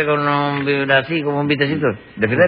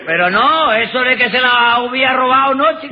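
mock yelling; 7 semitones higher than usual. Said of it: "No me grite".

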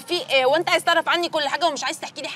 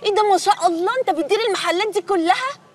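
higher and louder, wide-eyed amazement; impressed surprise; 8 semitones higher, 5 dB louder than usual. Acted. To the brother on hearing he runs the shops, loud impressed surprise. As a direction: marvel at him, pitch jumping up. Sister marvels at his job.

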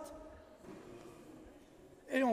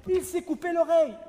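loud and higher, urgent; worried mother; 6 semitones higher, 10 dB louder than usual. Acted. Mother telling the doctor her son is hurt, loud and urgent. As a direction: blurt out the injury.

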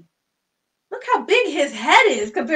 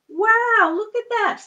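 amazed wow; 10 semitones higher, 6 dB louder, slower than usual. Pretend. Showing magic hat contents.

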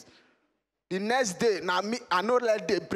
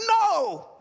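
put-on boy's denial; 11 semitones higher, 6 dB louder than usual. Boy denies stealing.

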